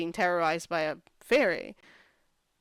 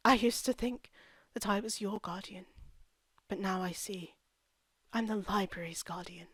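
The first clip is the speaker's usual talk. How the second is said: dropping to a soft hush for her big line; mock-solemn hushed voice; 5 dB quieter than usual. Retelling the villain's vow, soft and hushed.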